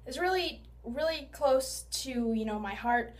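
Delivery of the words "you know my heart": usual speaking voice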